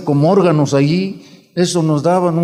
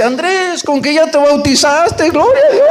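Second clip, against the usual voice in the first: joyful exclaim; 10 semitones higher than usual. Retold or imitated. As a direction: crow it out in laughing joy. Mother rejoices at son's baptism.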